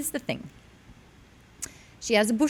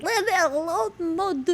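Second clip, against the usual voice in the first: higher, slow, put-upon French maid; sassy French dog; 11 semitones higher, slower than usual. Retold or imitated. Dog pushing away her owner's love, higher and sassy. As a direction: shoo him off, lightly French. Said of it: "She's like".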